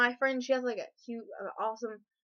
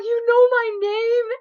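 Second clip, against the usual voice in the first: high, loud, slow amazed question; can't believe he knows you; starstruck disbelief; 11 semitones higher, 12 dB louder than usual. Retold or imitated.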